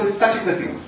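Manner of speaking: ordinary delivery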